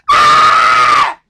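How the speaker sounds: put-on child's loud holler. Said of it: "holler loud enough so your mama hear".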